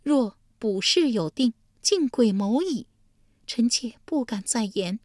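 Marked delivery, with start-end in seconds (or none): 0.0-2.9 mild anger. Acted. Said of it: "老祖爷怒道".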